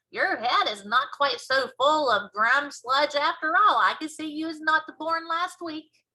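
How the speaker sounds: put-on giant fondly praising the girl; BFG voice.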